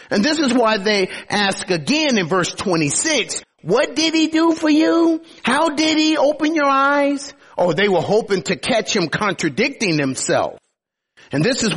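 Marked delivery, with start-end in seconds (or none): 3.6-7.3 high, taunting interrogator voice; religious leaders mocking the blind man's healing, high and taunting; mocking Pharisees. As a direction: sneer the question up high at the healed man. Pharisees' question voiced again.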